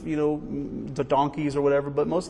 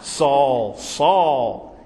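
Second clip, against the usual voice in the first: voice from heaven; 5 dB louder than usual. Retold or imitated. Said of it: "what does the voice say".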